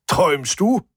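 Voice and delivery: high-pitched impatient call. A narrator's reading. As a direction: shout a prod through the door. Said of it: "rief Hubert". (ungeduldig rufend, hoch)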